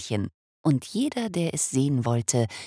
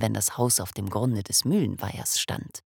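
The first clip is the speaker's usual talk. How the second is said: plain reading voice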